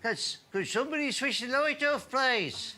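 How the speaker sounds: a bored punter politely asking to sleep, voiced high; balcony heckler. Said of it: "the guy up on one side of the balcony goes".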